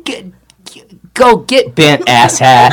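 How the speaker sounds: hammed-up parting jab; a touch of mock anger at a stranger; mock insult.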